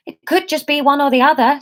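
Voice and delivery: high hopeful interjection; cling to a bit of hope. Acted. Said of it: "she interjected hopefully".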